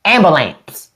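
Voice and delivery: AMBULANCE as Southern "am-buh-lamps"; clipped-vowel "am-buh-lamps"; deliberately wrong ambulance. Shown as a demonstration. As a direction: say "Ambulance" country-style, ending in "lamps". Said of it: "it's hard to say it wrong".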